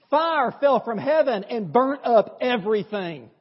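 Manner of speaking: ordinary delivery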